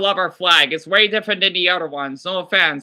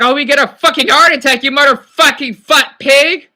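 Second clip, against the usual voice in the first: loud, high furious shout; venting at Boogie, raging loud yell; 6 semitones higher, 9 dB louder than usual. Real, not acted. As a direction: yell it, venting full rage. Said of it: "felt good to get that out of my system".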